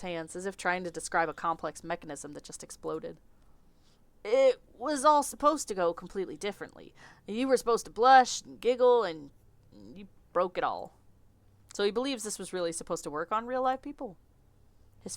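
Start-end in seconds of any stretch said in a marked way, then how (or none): 4.2-11.0 higher, louder flustered Southern complaint; lightly Southern, exasperated and shy; Southern accent. Acted. Victor blaming the clerk for ruining his flirt, light Southern accent. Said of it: "I don't know why he's Southern".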